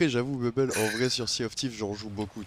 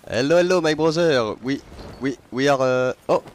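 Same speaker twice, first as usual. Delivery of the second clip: put-on "Hello, hello" shouted higher and much louder in a thick French accent; loud French-accented call; 4 semitones higher, 9 dB louder than usual. Streamer hailing a passing ship, loud French-accented shout. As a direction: belt out the greeting in a hammed-up French accent. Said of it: "j'en joue gavé du vieil accent franchouillard".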